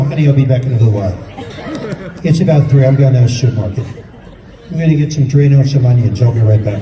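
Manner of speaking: low, loud, rushed sleepy husband; groggy husband. Acted. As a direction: grumble it like a half-awake husband. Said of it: "They wake up and go".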